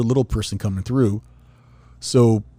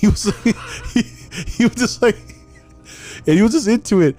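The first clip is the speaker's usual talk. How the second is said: much higher, laughing through it; 11 semitones higher, 4 dB louder than usual. Real, not acted. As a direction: talk while cracking up. Laughing while describing.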